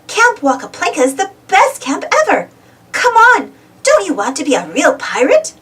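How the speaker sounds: high, bright push to come along; eager urging. A narrator's reading. Uniqua coaxing Pablo to join camp, eager. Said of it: "Uniqua urged him".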